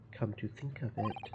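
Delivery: ordinary talk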